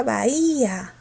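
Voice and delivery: BAHÍA with a drawn-out stressed I. Shown as a demonstration. Teacher asking if it takes an accent, stressed I in bahía. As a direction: stretch "bahía" as ba-Í-a. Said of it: "¿Pero quién tiene la fuerza?".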